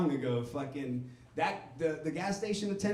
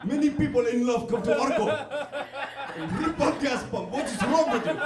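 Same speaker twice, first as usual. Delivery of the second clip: put-on loud, high Arabic-accented attendant, laughing; Arabic accent, exasperated and loud; 8 semitones higher, 6 dB louder than usual. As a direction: play an angry Arabic gas station attendant. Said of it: "all my accents that I do in comedy are Arabic people".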